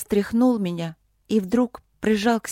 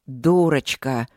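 low, slow angry male voice; seething lover, slow and low; furious man; 6 semitones lower, slower than usual. A narrator's reading. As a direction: draw the insult out angrily, pitched down. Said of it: "яростно процедил мне в лицо".

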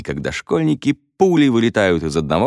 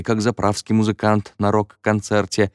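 ordinary delivery

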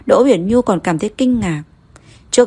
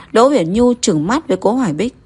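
ordinary delivery, nothing marked

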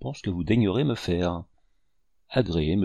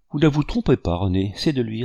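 ordinary delivery, nothing marked

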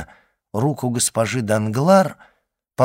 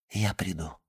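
whispering; 4 semitones lower, 10 dB quieter than usual. Acted. Secret exchange ends in whisper.

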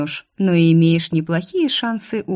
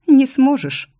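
high and loud, firmly certain; a know-it-all cutting him off; authoritative; 9 semitones higher, 6 dB louder, slower than usual. Acted. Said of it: "авторитетно заявила я". (авторитетно, громко и уверенно)

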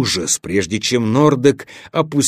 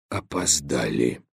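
hushed, defeated whisper; 8 semitones lower, 10 dB quieter than usual. A narrator's reading. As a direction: breathe it out, barely voiced. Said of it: "прошептал Белгор". (шёпотом)

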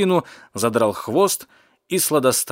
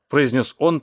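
plain reading voice

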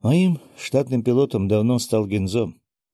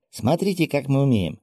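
put-on higher-pitched eager kid; child showing off; 5 semitones higher than usual. A narrator's reading. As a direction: show off like an excited child. (хвастливо, по-детски)

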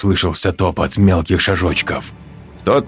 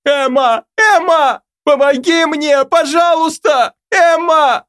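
high hysterical cries for help; hysterical screaming. A narrator's reading. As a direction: scream in panic, begging. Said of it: "в истерике продолжила кричать".

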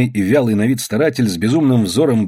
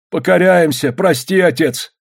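raised-pitch begging for pardon; submissive plea; 6 semitones higher than usual. A narrator's reading. Miners bowing to the authorities, pleading for pardon.

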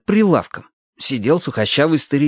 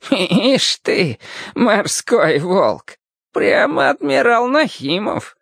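put-on higher, teasing old cobbler voice; amused old man; 9 semitones higher, much slower than usual. A narrator's reading. Old cobbler ribs the boy after his speech, amused. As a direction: tease the young sailor with mock admiration. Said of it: "Старичок дребезжаще засмеялся".

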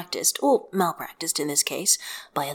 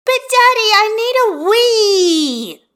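put-on high, loud, drawn-out child's wail; a desperate little girl begging; whiny little girl; 10 dB louder, slower than usual. A narrator's reading. Child figure Hestia.

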